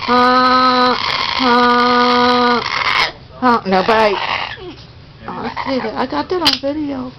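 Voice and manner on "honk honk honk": loud clownish honking; silly clown horn. Pretend. Horn game again.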